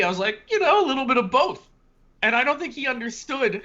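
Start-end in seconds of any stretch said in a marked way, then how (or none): 0.5-1.7 higher, louder quipping reply; being witty. Retold or imitated. Customer answering the stranger's odd question, playfully witty. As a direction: toss it back as a playful wisecrack. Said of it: "being, being witty".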